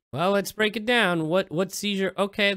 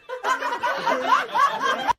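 a rising, very high burst of laughter. Real, not acted. Watching Yamcha die in a parody, high burst of laughter. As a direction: break into a high cackle. Cracking up at the clip.

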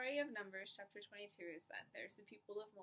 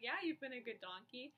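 grudging agreement; 4 semitones higher, 5 dB louder than usual. Acted. Balaam answers his donkey.

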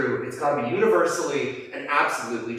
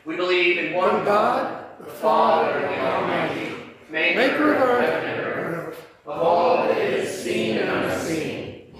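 higher, steady communal recitation; creed recited in unison; 5 semitones higher than usual. Real, not acted. Congregation standing, reciting the Nicene Creed in unison. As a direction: speak the creed together, measured and even.

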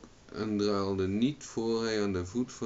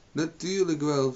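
indignant; 8 semitones higher, 5 dB louder than usual. A narrator's reading. Margaret answers surprised Owen.

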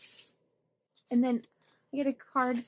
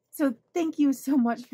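ordinary talk

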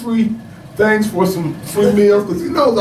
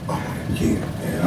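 hushed anticipation; 10 dB quieter than usual. Retold or imitated. Retold excitement at the drive-in.

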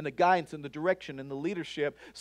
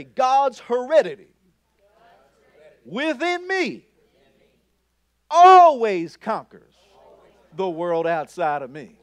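raised, firm confession, each phrase set apart; bold declaration; 2 semitones higher, 9 dB louder than usual. Shown as a demonstration. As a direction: proclaim it clearly but not yet at full force. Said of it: "So say this with me".

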